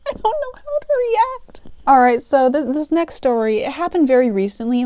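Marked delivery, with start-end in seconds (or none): 0.1-1.6 very high, helpless squeak; taken aback. Retold or imitated. Cashier stunned by customer's question, high bewildered squeak. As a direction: squeak it out, totally at a loss.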